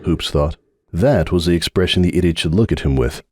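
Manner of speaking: ordinary delivery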